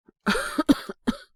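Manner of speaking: harsh feverish cough; loud rasping sickbed cough. Acted. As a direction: cough hard from a sore chest. Sick boy in bed.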